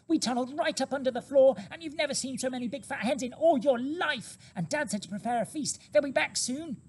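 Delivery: put-on high, loud young fox, insistent and thrilled; eager small fox. A narrator's reading. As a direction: cub convincing his weak mother.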